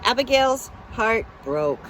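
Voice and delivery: usual speaking voice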